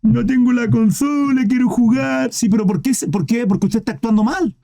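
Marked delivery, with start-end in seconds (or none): 0.0-2.3 mock childish whining. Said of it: "Llorando como un niño".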